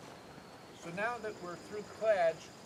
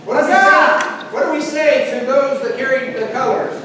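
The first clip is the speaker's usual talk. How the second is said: very loud, high cheer leader call; rally the crowd for the colors; loud cheerleading; 5 semitones higher, 23 dB louder than usual. Real, not acted. Cheer led loudly.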